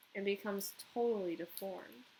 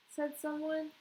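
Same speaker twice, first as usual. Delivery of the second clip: ordinary delivery, nothing marked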